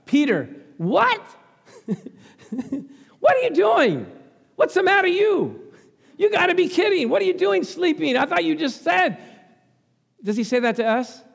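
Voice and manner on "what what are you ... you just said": incredulous scolding. Retold or imitated. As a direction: scold a sleepy friend in disbelief. Jesus scolding sleeping Peter.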